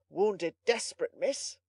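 understated smug satisfaction; great complacency. A narrator's reading. Butler reporting the captive's wounds to miss, complacent tone. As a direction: report it as if quite pleased with yourself. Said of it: "with indescribable complacency".

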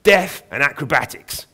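slow, loud, high raven crow with a Cockney twang. Acted. Raven telling Aubrey its hobbies, slow gleeful crow. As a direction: crow it out with relish. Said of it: "crowed Corax".

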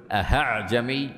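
أهعجمي with the hamza wrongly replaced by a clear ه; hamza turned to ha. Shown as a demonstration. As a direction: say أهعجمي, breathing an h where the hamza should be. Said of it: "فتصبح هاء". (أعجمي تنطق خطأً بالهاء بدل الهمزة)

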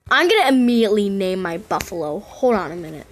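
ordinary delivery

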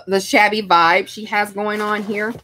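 ordinary delivery

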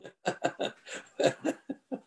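a soft breathy laugh at the question; a brief quiet real laugh; amused laugh. Real, not acted. Laughs at question about failing.

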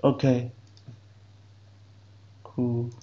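normal talking voice

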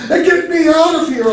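high-pitched panicked bawl; high, urgent cry for help. A narrator's reading. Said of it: "I bawled".

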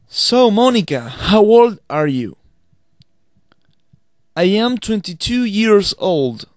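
slow, careful English for learners to repeat; slow dialogue demo. Shown as a demonstration. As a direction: read each line clearly, slowly, as a model to repeat. Teacher reads English dialogue aloud.

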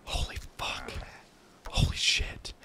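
voiceless whispered disbelief; hushed, under-the-breath swearing; stunned whisper. Real, not acted. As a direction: whisper it in awe. Stunned after breakthrough in game.

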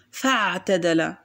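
فاعتدل with no glottal stop after fa; hamza dropped. Shown as a demonstration. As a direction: run fa straight into ʕ in فاعتدل. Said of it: "أيضًا لم أنطق الهمزة".

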